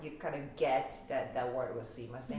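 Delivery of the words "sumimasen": sumimasen in Osaka intonation, soft start and rising sen; Osaka-style sumimasen, quieter, ending on a rise; Osaka accent. Shown as a demonstration. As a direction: blur the first part of sumimasen, Osaka-style. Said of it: "the first part was a little".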